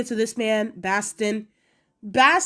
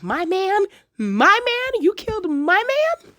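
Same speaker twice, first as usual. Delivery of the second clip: mock outraged Rogue, pitched higher; 9 semitones higher, 4 dB louder, slower than usual. Vlogger mocking Rogue's complaint in a higher indignant voice. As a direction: parrot her outrage back in disbelief.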